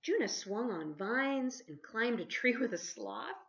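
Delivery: ordinary delivery, nothing marked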